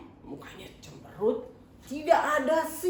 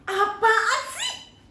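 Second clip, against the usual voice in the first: put-on grumpy child; 10 semitones higher, 6 dB louder, much slower than usual. Teacher acts a grumpy child.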